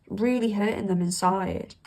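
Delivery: ordinary delivery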